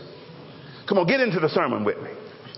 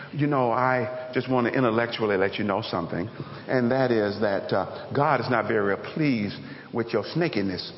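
much lower, soft, pompous hedging; mock intellectual; 10 semitones lower, 3 dB quieter than usual. Preacher parodying an over-intellectual John, low and pompous. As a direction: sound condescendingly intellectual. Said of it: "he could have said".

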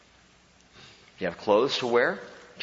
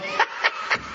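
gleeful laugh. Acted. Joyful giver at offering box.

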